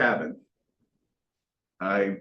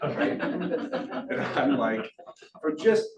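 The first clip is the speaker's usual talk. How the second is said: ordinary delivery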